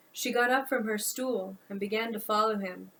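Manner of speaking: usual speaking voice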